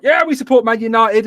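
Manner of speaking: high, loud imitation of a fan; mock fan enthusiasm. Retelling film bluff to hooligans, mock enthusiasm. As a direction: pretend rallying cheer.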